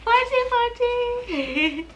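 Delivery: high, slow cooing tease. Real, not acted. Teasing her baby.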